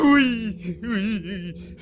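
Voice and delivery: tearful giving in. Acted. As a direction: crack as you give in. Giving in under the shouting. (oui brisé, au bord des larmes)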